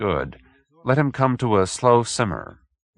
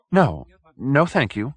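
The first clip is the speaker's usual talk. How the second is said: high, fussy polite greeting; a nervous little gentleman bowing in; timid little man; 5 semitones higher, slower than usual. Acted. Hoag greeting the detective, high and fussy.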